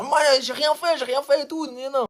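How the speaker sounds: high, whining mock protest; whiny protest. Boy pleading innocence to the supervisor, high whine.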